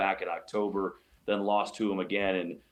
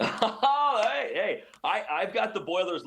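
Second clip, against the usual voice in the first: laughing protest; 11 semitones higher than usual. Real, not acted. Reacts to Purdue teasing.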